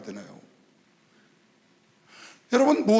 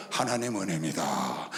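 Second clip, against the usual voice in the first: weak breathless thanks; 5 dB quieter, slower than usual. Retold or imitated. Weak patient thanking God.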